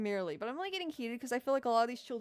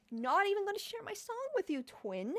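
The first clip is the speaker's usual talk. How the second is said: meme quote; 9 semitones higher than usual. Retold or imitated. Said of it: "quoting meme again".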